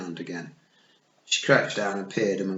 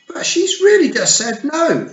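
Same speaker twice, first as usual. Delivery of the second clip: much higher, loud outburst; burst out; 12 dB louder than usual. A narrator's reading. Fionn protesting to the clan, loud blurt. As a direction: blurt it out in alarm. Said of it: "Fionn burst out".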